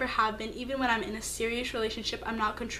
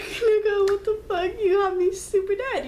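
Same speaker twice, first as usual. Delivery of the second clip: incredulous outburst; 9 semitones higher, 4 dB louder than usual. Retold or imitated. Reacting to his excuse.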